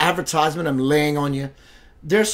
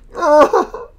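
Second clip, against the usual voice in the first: high laugh; 12 semitones higher, 8 dB louder than usual. Real, not acted. After his mock whine.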